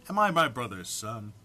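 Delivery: ordinary talk, nothing marked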